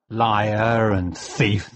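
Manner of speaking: very slow, deliberate words; slow insult. Acted. John insulting Aldebrand on the way out, very slow. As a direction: spell each word out slowly.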